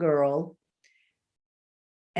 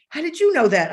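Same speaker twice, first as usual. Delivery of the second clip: mock surprise; 8 semitones higher, 6 dB louder, faster than usual. Kids quoted, surprised.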